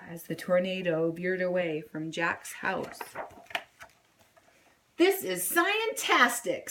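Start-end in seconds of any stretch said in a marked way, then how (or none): 5.0-6.7 high gleeful exclaiming; thrilled yell. A narrator's reading. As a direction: marvel at the storm. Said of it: "Sadie yelled".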